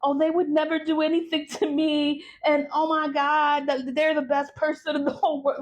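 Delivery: high, gushing lovestruck parody; mocking a smitten victim. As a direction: play the bewitched fool who adores them. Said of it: "and then you'll be like".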